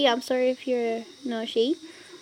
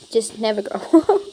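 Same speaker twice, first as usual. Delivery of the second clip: laughing; 8 dB louder than usual. Real, not acted. Laughing advice to commenter.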